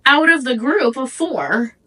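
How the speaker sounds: normal talking voice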